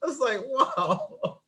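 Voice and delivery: high, slow, breathless laugh-talk; wheezing with laughter, voice breaking; helpless laughter. Real, not acted. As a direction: laugh till you can barely speak. Laughing hard after the story.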